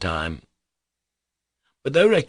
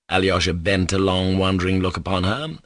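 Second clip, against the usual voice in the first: usual speaking voice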